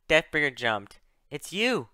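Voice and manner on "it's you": higher, rising cry of recognition; surprised exclamation. Acted. Deathbringer recognises Ren after years apart, exclaiming. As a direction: exclaim it as you recognise them. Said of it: "he exclaimed".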